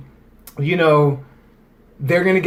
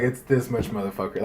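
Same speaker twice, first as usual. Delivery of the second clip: mock tough aside; 6 semitones lower, 9 dB quieter than usual. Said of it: "she didn't say that".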